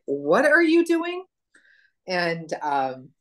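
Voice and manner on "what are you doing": high, loud and slow, mock astonished; startled question. Shop girl baffled by the customer, high mock astonishment. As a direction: stretch it out in high disbelief, imitating her. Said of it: "She's like".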